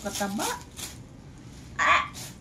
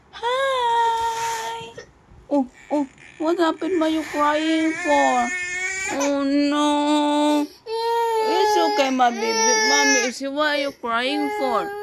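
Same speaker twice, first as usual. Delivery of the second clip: higher, louder, slow cooing to comfort; 4 semitones higher, 6 dB louder, slower than usual. Real, not acted. Mother comforts her crying baby in loud, high baby talk.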